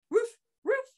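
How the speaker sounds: high-pitched barking; high dog bark. Acted. Dog barks from the roof.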